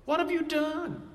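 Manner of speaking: high, fast, disbelieving questions; like a mom scolding a kid; exasperated Pilate. Retold or imitated. Pilate to Jesus, echoing the scolding mom, high and exasperated. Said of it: "And Pilate's saying".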